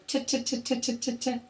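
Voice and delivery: light rhythmic "T" syllables for a speech test; repeated T sound. Shown as a demonstration. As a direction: tap out "T" again and again at a steady rate.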